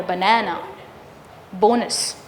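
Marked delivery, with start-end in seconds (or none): 0.0-0.6 "Banana" with a long middle vowel, short outer ones; longer second syllable. Shown as a demonstration. Teacher showing the schwas in "Banana", second syllable longer. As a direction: stretch the middle of "Banana", schwa either side. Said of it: "The second one is actually longer".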